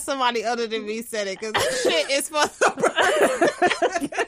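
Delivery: loud, high, laughing out; laughing rant. Real, not acted.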